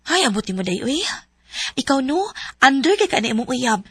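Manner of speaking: usual speaking voice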